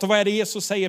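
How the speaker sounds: ordinary talk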